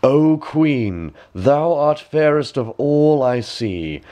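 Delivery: lightly voiced oracle mirror; slow, solemn answer from the glass; a hint of a slow solemn mirror. A narrator's reading. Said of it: "it answered as before".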